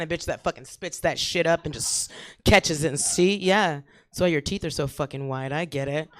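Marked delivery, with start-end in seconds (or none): none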